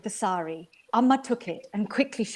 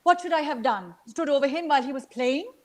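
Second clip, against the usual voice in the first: higher, indignant wife firing back; an exasperated mother defending herself, higher; mother's indignant reply; 5 semitones higher than usual. A narrator's reading. Mother answers in the quarrel.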